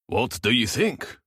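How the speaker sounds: put-on slow, thick Norwegian accent. A narrator's reading. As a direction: draw it out in a heavy Norwegian accent. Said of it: "in his thick Norwegian accent".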